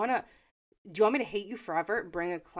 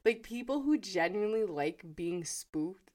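normal talking voice

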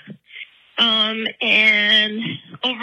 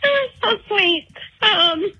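tearful advice; 7 semitones higher than usual. Real, not acted. Caller crying while giving advice.

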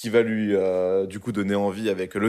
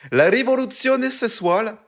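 put-on over-the-top Italian accent, pitched much higher and slowed; hammed-up Italian; 11 semitones higher, 4 dB louder than usual. Asked by friends to say the titles in Italian, hammed-up Italian accent. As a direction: ham up the Italian titles like a proud native, then lose your nerve on commandamenti. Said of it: "je peux le faire si vous voulez".

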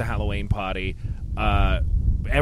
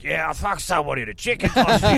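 Kappa impression; 5 semitones higher than usual. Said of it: "my Kappa impression".

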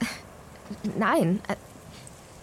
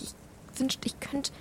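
higher and softer, almost breathless; wistful, quiet; 6 semitones higher, 6 dB quieter, slower than usual. Acted. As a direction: let the wish barely come out. Sorge um den leidenden Freund. (leise, sehnsüchtig und höher)

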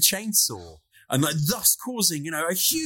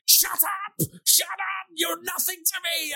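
mock furious yell; slower than usual. Kinski yelling back at hecklers.

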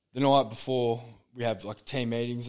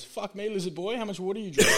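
a higher, put-on voice for the stoned koala; lightly voiced stoner koala, higher; 9 semitones higher than usual. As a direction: deliver the punchline as a lazy, blazed koala. Koala's punchline voiced in joke.

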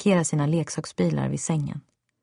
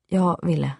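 plain reading voice

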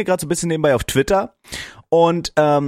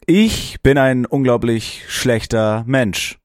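slow mock confession; much slower than usual.

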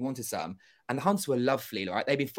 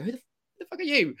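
high, startled and a bit louder; much higher, startled who-are-you; shocked outburst; 14 semitones higher, 3 dB louder than usual. Retold or imitated. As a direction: blurt it out, thrown by a stranger. Retold confused outburst at stranger.

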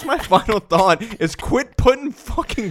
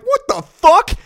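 high, louder shocked outburst; startled yell; 13 semitones higher, 8 dB louder, slower than usual. Retold or imitated. Thinking someone honks at him, high loud shock. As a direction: jolt in fear and swear. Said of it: "I'm like".

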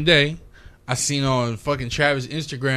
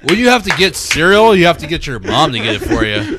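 louder laughing troll taunts; mock hater voice; 9 dB louder than usual. Mocking a co-host as a troll, loud laughing taunts. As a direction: ham up the jeering hater. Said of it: "It's not what I sound like at all".

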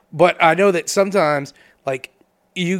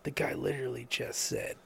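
much softer and lower, under the breath; hushed aside; 4 semitones lower, 12 dB quieter, slower than usual. Acted. Correcting Mike discreetly during a meeting, hushed. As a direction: quietly correct a colleague so others won't hear.

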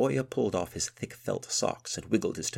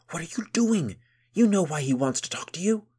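whisper yelling; 11 semitones higher, 8 dB louder than usual. A narrator's reading. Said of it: "she hissed in a furious whisper".

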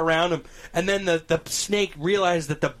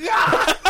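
very high, loud mock shriek; panicking snake scream; 7 dB louder than usual. Storyteller voices the snake grabbed by its tail, mock scream. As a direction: scream as a flipping-out animal, played for laughs. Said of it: "And the snake is like".

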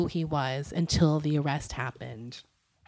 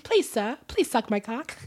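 higher, mock English orphan accent; Oliver; 4 semitones higher than usual. Lewd spoof of an orphan begging, mock English accent. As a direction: beg politely like an English orphan. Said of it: "I'm gonna just sound like Oliver".

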